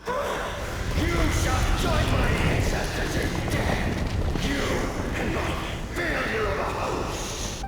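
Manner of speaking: enraged villain shout; villain threat. Acted. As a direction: threaten them with death, full force.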